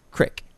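"Creek" said as "crick", short i; dialect pronunciation. Shown as a demonstration. Reader settling on a word from the report, said "crick". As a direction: settle on "Creek" rhyming with "trick". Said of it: "fallen into the creek".

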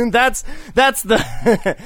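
slow words broken up by laughter; laughing. Real, not acted. Teasing his friend about being a dude, laughing. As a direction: laugh through the words as you tease.

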